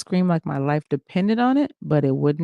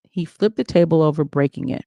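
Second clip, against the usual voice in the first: ordinary delivery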